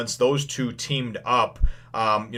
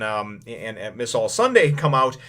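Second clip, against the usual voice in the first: ordinary talk, nothing marked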